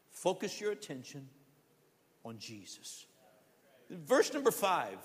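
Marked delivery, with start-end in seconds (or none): 0.1-3.1 soft appeal. Real, not acted. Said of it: "I want to encourage you".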